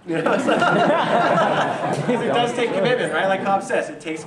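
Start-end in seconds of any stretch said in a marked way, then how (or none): 0.6-2.3 bright burst of laughter, pitched up; loud high laugh. Real, not acted. Speaker laughing at his own teenage boldness, big laugh.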